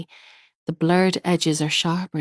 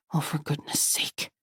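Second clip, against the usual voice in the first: whispered, exasperated mutter; under her breath; 8 dB quieter than usual. A narrator's reading. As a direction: breathe it out to herself. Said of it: "she mutters under her breath".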